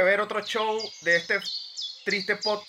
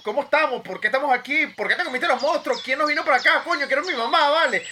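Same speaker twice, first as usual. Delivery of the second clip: panicked; 8 semitones higher, 4 dB louder, faster than usual. Acted. Sketch, lost on monster island.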